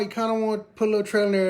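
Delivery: ordinary talk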